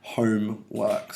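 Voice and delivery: "homework" slowly split, weight on "home"; stress on HOME. Shown as a demonstration. Teacher showing where the stress is, "homework" slow with stress on "home". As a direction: lean on "home", then a separate "work". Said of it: "show you where the stress is".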